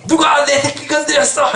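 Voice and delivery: high, shouted parental outrage; angry protective parent. Acted. Parent storming in for their little one, angry shout. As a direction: yell like a parent defending their child. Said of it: "우리 애기들한테 그러잖아요".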